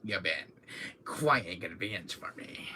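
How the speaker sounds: low, soft villain line; low smug villain murmur; Wesker impression. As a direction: purr it coldly. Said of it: "with Wesker".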